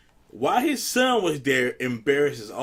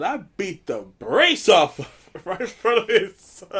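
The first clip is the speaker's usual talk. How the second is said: laughing through the quote; 6 semitones higher, 5 dB louder than usual. Retold or imitated. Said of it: "And he said".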